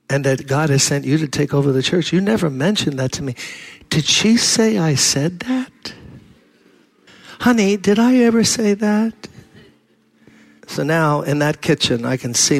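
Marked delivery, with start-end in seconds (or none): 3.9-9.1 sweet wife denial. Retold or imitated. Couple voiced sweetly denying.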